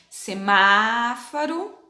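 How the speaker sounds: "semáforo" slowly, with a long, open MÁ rising in pitch; open stressed A. Shown as a demonstration. Teacher reading group one aloud, open stressed A. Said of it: "Lê em voz alta".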